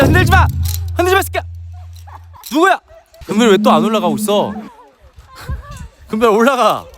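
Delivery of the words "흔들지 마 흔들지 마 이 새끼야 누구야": angry yell. Real, not acted. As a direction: yell at them to stop. Yells at shaker above.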